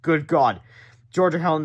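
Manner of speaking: ordinary delivery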